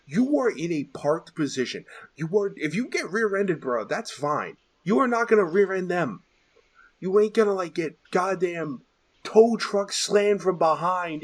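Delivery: high-pitched, heated complaint; exasperated rant. Real, not acted. Host rants about drivers not moving up in line, exasperated. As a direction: rant as if fed up with bad drivers.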